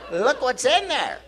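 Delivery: high, slow, goofy cartoon voice; silly cartoon voice. Comic showing his bald head, high cartoon voice. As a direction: pop the lid like a Monty Python cartoon. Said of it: "like a Monty Python cartoon".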